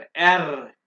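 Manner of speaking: एर said with a strong trilled r; rolled r. Shown as a demonstration. Teacher repeating the rolled r for the class, trilled. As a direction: trill the tongue hard on एर. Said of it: "यहाँ पे जुबान को vibrate करें".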